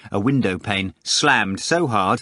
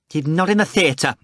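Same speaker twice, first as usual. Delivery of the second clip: higher-pitched irritated yell; 7 semitones higher, 3 dB louder than usual. A narrator's reading. Manager fending off an unknown caller, yelling. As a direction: yell it into the receiver. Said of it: "Varyonika began to yell".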